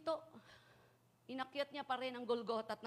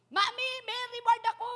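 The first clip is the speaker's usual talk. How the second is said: put-on much higher and louder, happy child calling out; small child telling Mami about a reward; excited child; 12 semitones higher, 10 dB louder than usual. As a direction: shout it like a thrilled kid running home.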